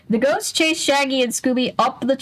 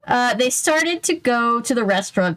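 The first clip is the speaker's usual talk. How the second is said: usual speaking voice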